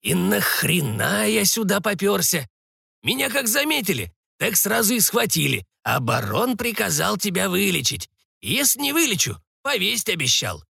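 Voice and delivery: put-on high-pitched grumpy old sorcerer; grumbling old man. Old sorcerer complaining about being seized, grumbling.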